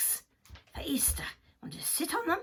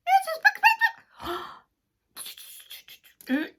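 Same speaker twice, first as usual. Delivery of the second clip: very high loud squawk; excited hen squawk; 11 dB louder, faster than usual. Acted. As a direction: burst out like a clucking hen.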